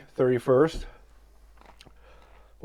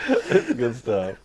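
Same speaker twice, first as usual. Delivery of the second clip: laughing; slower than usual. Real, not acted. Repeats the phrase while laughing.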